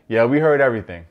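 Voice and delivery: put-on retelling a girlfriend cutting in loud; mock loud retort. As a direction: snap back at the rival. Jessica cutting in on Melinda.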